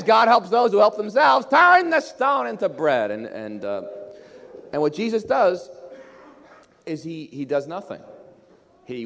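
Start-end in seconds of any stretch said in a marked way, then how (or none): 0.0-3.1 high, loud tempter's harangue; shouted command. Acted. Satan orders Jesus to make bread, shouted command. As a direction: preach it at full shout as the tempter.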